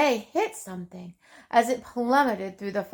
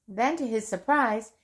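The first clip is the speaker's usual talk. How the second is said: ordinary delivery, nothing marked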